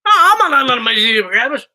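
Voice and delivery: loud, high mush-mouthed outburst; hammed-up garbled voice; put-on comic voice. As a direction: blurt it as a garbled cartoon protest. Nonsense bit in put-on voice.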